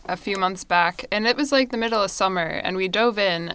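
ordinary delivery

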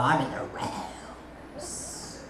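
slow, breathy whispered awe. Retold or imitated. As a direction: whisper it as if lost in glory. Repeated very slowly in a whisper.

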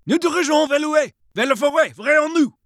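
high-pitched French yell; yelling after someone. A narrator's reading. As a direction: call directions to a man running off. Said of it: "Renoir yelled after him".

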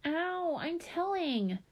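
high, drawn-out whine; tattling little child. A narrator's reading. Five-year-old yanked by her sister, whining threat to tattle.

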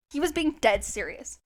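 ordinary talk

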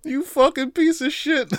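high, slow cursing while near laughing; high mock curse. Real, not acted. Cursing the sequel makers, high and slow.

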